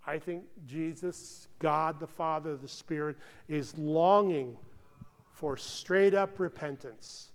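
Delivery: usual speaking voice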